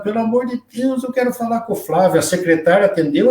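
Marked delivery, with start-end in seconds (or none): 0.0-2.2 higher, pleading in mild panic; a hint of retold desperation on the phone; desperate plea. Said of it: "mas eu liguei desesperado".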